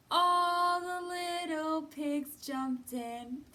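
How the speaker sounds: ordinary delivery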